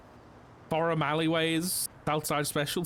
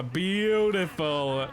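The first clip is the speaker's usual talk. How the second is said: Beautiful stretched very slowly, savoured; drawn-out praise; 2 semitones higher, 3 dB louder, much slower than usual. Real, not acted. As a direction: draw out Beautiful like admiring art.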